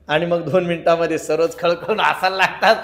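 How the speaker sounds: normal talking voice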